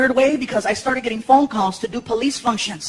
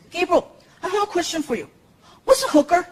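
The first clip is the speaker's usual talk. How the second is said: put-on higher innocent kid; 7 semitones higher than usual. Son asking dad a question, higher kid voice. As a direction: ask like a curious little boy.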